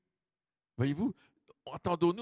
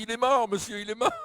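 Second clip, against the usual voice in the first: alarmed cry; 6 semitones higher, 6 dB louder than usual. Retold or imitated. Lady in crowd cries out.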